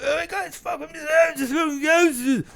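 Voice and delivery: high, slow, shouted [name] gasp; [name] impression. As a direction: bellow it out amazed like [name]. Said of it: "So he's like".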